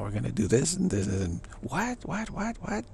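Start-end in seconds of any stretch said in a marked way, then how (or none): 1.6-2.8 high, a touch of exasperated "what, what"; high mock bewilderment; mock baffled. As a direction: play innocent confusion, pitched up.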